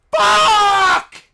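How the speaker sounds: loud, high swear; furious yelled curse; angry shout. Real, not acted. Frustrated in game.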